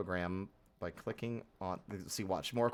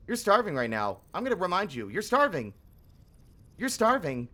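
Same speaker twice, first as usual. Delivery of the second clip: higher, louder, nagging singsong; nagging game voice; 8 semitones higher, 4 dB louder than usual. Retold or imitated. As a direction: pester like an annoying reminder. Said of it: "and the game's like".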